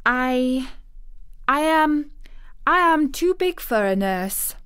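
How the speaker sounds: falter, then push the words out; timid girl. A narrator's reading. Girl nervously objecting to having a nurse, high and hesitant.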